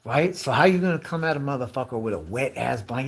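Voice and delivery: usual speaking voice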